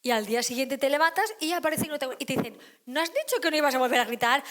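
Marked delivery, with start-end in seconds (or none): 2.9-4.4 loud, fast reproach. Retold or imitated. Family member reproaching the parent, loud and fast. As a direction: call them out on the broken promise, loudly and quickly.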